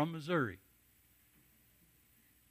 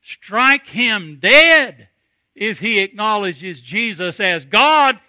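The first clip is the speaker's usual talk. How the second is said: slow, very loud shout, drawn out word by word; booming slow declaration; 6 semitones higher, 21 dB louder, slower than usual. Acted. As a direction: thunder it out like a fiery preacher. Preacher's mock warning.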